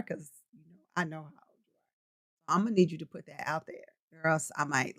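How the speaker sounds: a mock conspiratorial hush to a viewer; teasing low voice. Real, not acted. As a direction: drop low and quiet, playfully threatening. Teasing a viewer about age.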